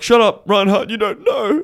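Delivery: hammed-up wounded protest; telling off his friend in a high, loud mock huff; mock defensive snap. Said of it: "And I'm like".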